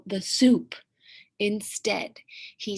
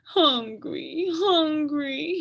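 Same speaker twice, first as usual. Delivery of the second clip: higher, rising whimper; whimpering dragon; 7 semitones higher, 4 dB louder, much slower than usual. Acted. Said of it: "he whimpered".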